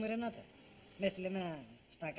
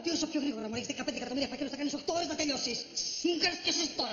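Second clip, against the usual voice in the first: fast, raised, exasperated outburst; angry shouting; 5 semitones higher, 3 dB louder, faster than usual. Acted. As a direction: rail impatiently, pitch climbing. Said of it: "Φωνάζεις".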